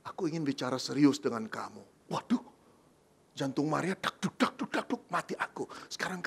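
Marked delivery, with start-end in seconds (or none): 0.0-2.1 low, hushed, serious whisper. Acted. Joseph whispers seriously to Mary. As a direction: whisper it like a grave confession. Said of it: "Yusuf berkata".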